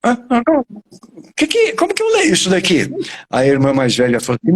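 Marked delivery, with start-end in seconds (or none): 0.0-2.9 high-pitched imitation of a child stuck reading; high child voice, baffled; puzzled little girl. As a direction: play a young reader asking for help, much higher. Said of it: "Ela falou".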